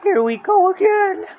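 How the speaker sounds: Shaggy panicking again; scared. Acted. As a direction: squeal it in dread. Shaggy fearing the monster.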